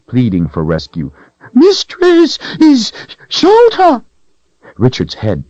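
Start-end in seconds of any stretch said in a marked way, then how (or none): 1.5-4.0 very high, loud shrieking claim; possessive creature cry. A narrator's reading. Creature shrieking at Richard that the sword is his. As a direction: shriek it like it's yours.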